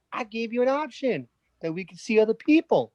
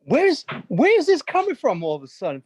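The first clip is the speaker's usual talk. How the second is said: louder, panicked disbelief; 5 dB louder than usual. Acted. Fiancé hit by a breakup, loud panicked disbelief. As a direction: blurt it out in sudden panic. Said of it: "your panic was killing me".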